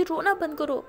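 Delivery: loud crying plea of love; crying outburst. A narrator's reading. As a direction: shout it through tears. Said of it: "तो ऐसे बोल के बहुत रो रही होती है".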